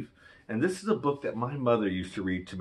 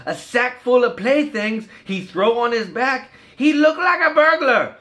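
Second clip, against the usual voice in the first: put-on higher, louder Cajun storyteller voice for the kids; Cajun story reading; 13 semitones higher, 9 dB louder than usual. Reading a Cajun Christmas book to kids, higher, louder Cajun storyteller voice. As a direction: spin the Cajun tale with lively bayou flair.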